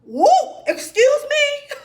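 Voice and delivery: high, laughing "excuse me?"; laughing disbelief. Real, not acted. Daughter laughing at her mother's bluntness, high and incredulous. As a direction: laugh it out in mock offense.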